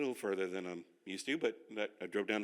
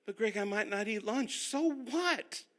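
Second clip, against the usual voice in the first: mock objection; 10 semitones higher, slower than usual. Imagined objection and reply.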